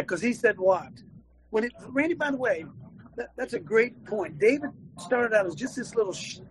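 ordinary delivery

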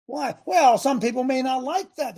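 ordinary delivery

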